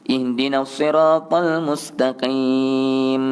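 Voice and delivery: slow chant, long m closing "mustaqim"; sustained hum ending "mustaqim"; clear mim recitation. Shown as a demonstration. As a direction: recite and hold the final m of "mustaqim" with closed lips. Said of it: "supaya jelas bunyi mim".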